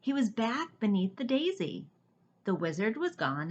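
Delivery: usual speaking voice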